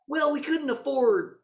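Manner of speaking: loud high cartoonish brother; high loud mock voice. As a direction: explain it in a silly high voice. Voicing a brother explaining.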